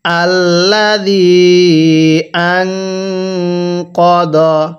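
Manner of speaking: drawn-out chanted Arabic reading; slow Quran recitation. Shown as a demonstration. Teacher models Arabic recitation for learners, slow and drawn-out. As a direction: recite each syllable long and even, like a teacher modelling. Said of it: "কিভাবে শিখব?".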